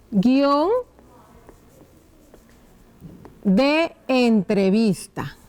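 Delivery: stretched title with a long gap inside; slow dictation. Shown as a demonstration. Teacher writing the title above the script, slow dictation. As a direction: draw out each part while writing.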